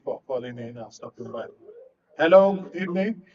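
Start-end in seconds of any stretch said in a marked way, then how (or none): none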